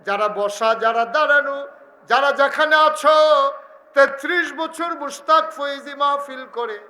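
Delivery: high, loud address to the crowd; full-force preaching. Real, not acted. Preacher calling on all present in the pandal, shouted sermon. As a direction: shout to everyone under the tent.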